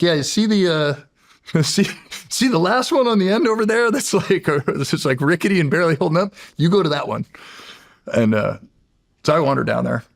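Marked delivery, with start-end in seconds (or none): 0.0-7.3 high, laughing put-on voice of the teasing veterans; high, giggly mock voice sending the rookie to the rickety chair; mocking veteran guards. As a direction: ham it up like old hands hazing the new guy.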